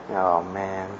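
ordinary talk

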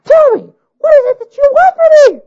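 high, loud frazzled bride; screeching bride. A narrator's reading. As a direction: shriek it high, nerves frayed.

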